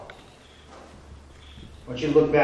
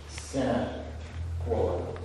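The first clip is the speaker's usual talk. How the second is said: whispered aside; 6 semitones lower, 11 dB quieter, much slower than usual. Shown as a demonstration. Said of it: "whispered comment".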